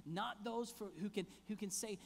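ordinary delivery

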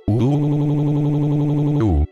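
high, sustained "uuuh" imitating a crowd; mock crowd uproar. A narrator's reading. Furious students jeering at Naruto, mock high-pitched uproar. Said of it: "gritaban todos los estudiantes con furia".

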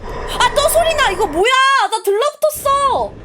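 high, loud alarmed outburst; panicked yelling. Real, not acted. Player feels a ghost cling on, high panicked shouting. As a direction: yell in panic as something grabs you.

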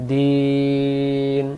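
slow, drawn-out melodic tajweed chant; Quran recitation. Acted. Teacher chanting verses for students in slow tajweed. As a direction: stretch each vowel long in recitation.

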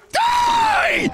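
high-pitched screamed burst; real scream. Real, not acted. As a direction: scream it at the enemy, shooting way up in pitch. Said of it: "I think I just screamed him to death".

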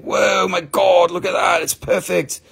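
high-pitched mock amazement; hammed-up awe, pitch jumping up; amazed. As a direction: gush as if just discovering it. Reenacts amazement at the crystal.

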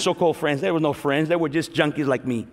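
ordinary talk

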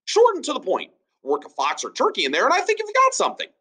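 high, rushing cheerful advice; high chirpy Ben. Acted. Ben cheerfully improving the townsfolk's saying, high and fast. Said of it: "said Ben".

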